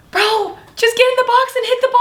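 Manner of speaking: very high-pitched mock exasperation; exasperated outburst. Catcher reacting to a screaming batter, high mock exasperation. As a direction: squeal it as a fed-up complaint.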